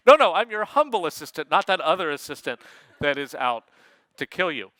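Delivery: hammed-up assistant's denial, settling down; mock humble assistant. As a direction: hurriedly reassure as the humble machine. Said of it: "instead they picked humble".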